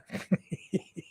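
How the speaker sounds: short breathy chuckle, quickly stifled; guilty laugh. Real, not acted. Host laughs at own nickname joke, stifled chuckle. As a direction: laugh despite yourself at the joke. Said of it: "I shouldn't even be laughing".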